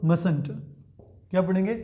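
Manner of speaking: ordinary talk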